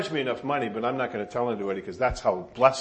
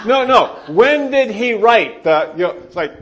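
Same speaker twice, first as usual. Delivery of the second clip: exasperated outburst; 9 semitones higher, 12 dB louder than usual. Retold or imitated. Pastor demanding the psalm's origin from search results, exasperated. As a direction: plead loudly with a useless search engine.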